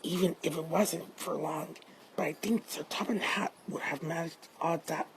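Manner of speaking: hushed private grumble; murmured. Acted. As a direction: murmur it quietly to oneself. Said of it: "he murmured".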